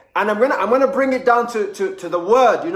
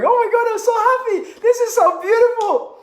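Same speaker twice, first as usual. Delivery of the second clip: much higher, loud delighted gushing; giddy, pitched way up with delight; gushing joy; 13 semitones higher, 3 dB louder than usual. Pretend. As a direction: squeal with over-the-top happiness. Overjoyed person looking around.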